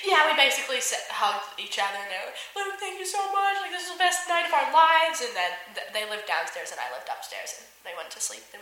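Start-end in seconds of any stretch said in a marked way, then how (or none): 2.5-5.2 high-pitched, gushing gratitude; imitated gushing thanks. Staff hugging friend after beach night, gushing thanks. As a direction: mimic friends overflowing with thanks.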